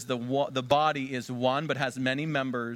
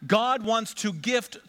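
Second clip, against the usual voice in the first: slow emphatic; 9 semitones higher, 4 dB louder, much slower than usual. Real, not acted. Climactic slow sermon line.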